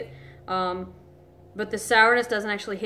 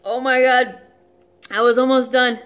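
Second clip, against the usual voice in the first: exasperated; 3 semitones higher, 8 dB louder than usual. Real, not acted. Struggling to finish lemon juice.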